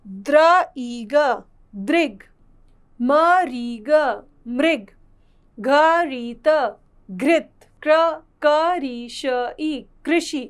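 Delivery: slow separate "म", "री", "ग", then blended "मृग"; reading aloud by sounds. Shown as a demonstration. Teacher reading words out with students, sounding out each letter. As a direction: sound out "घ री त", pause, join as "घृत". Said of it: "आइए बोल बोल कर पढ़ते हैं".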